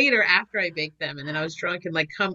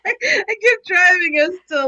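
laughing while talking; 9 semitones higher, 7 dB louder, slower than usual. Real, not acted. Laughing through the story.

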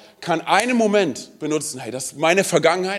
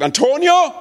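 loud, high call of the name; mother calling full name; 9 semitones higher, 6 dB louder, slower than usual. Retold or imitated. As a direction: call him like an angry mother. Mutter ruft vollen Namen. (lauter Ruf der Mutter)

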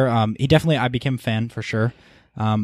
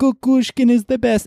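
high-pitched mock cheer; imitated fan scream; 12 semitones higher, 3 dB louder than usual. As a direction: answer your own chant like a rowdy fan.

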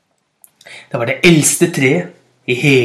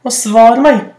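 loud plea; 8 semitones higher, 4 dB louder than usual. Acted. Bird shouting plea to tree.

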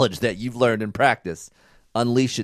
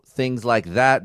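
normal talking voice

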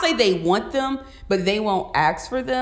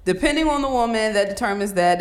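usual speaking voice

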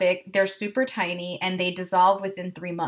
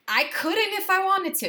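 laughing protest; 11 semitones higher than usual. Real, not acted.

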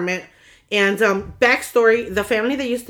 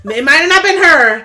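laughing; 6 semitones higher, 9 dB louder than usual. Real, not acted. Joking back amid laughter.

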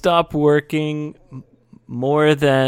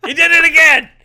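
much higher and louder, gleeful accusation; triumphant gotcha shout; 8 dB louder, much faster than usual. Real, not acted. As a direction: catch him red-handed out loud. Catching him using Kansai word. (caught you using the Kansai word again, gleeful shout)

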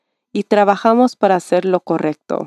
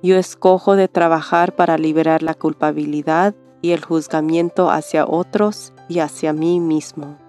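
calm them gently, line by line; soothing spa whisper. Acted. Leads a meditation. (voz suave de meditación guiada)

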